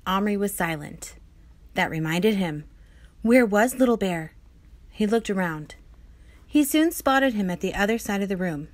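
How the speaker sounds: usual speaking voice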